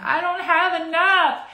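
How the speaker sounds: mock whine. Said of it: "being like".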